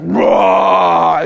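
mock roar of a man waking; Ken Graves. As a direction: roar in an imitated preacher's voice. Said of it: "This is Ken Graves".

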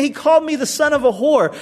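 a mock hurt son, mild; imagined wounded son. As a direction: son griping about his father, voiced lightly. Voicing Jonathan's imagined complaint.

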